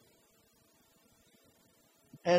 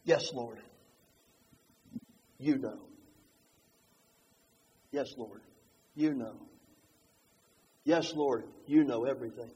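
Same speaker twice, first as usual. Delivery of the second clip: soft, low, halting and humble; hushed humble confession; simple humble reply; 8 semitones lower, 8 dB quieter than usual. Retold or imitated. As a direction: answer quietly, no boasting left. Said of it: "he simply says".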